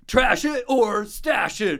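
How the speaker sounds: slow, high, loud title announcement; big drawn-out game show shout; game show announcer. Pretend. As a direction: belt out the game's name like a host.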